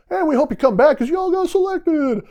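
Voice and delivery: high, loud, beaming reveal of good news; cheery announcer. Retold or imitated. Instructors springing the surprise on the candidates, beaming and high. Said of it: "they just go".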